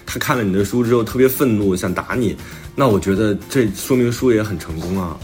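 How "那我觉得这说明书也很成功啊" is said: ordinary talk, nothing marked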